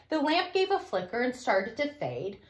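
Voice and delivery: ordinary delivery, nothing marked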